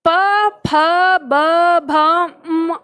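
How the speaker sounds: प, फ, ब, भ, म each said apart with the lips closing; labial letters demo. Shown as a demonstration. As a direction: sound out प फ ब भ म. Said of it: "होठ मिलने की वजह से". (प, फ, ब, भ, म का होठों से स्पष्ट उच्चारण)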